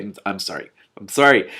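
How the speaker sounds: ordinary delivery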